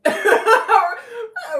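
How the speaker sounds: sudden loud burst of laughter; big laugh breaking out. Real, not acted. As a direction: crack up loudly. Bursts out laughing mid-rant.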